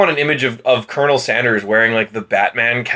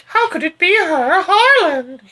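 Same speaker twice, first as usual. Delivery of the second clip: melodramatic disbelief. Retold or imitated. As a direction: wail it like a soap heroine. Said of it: "And she, like".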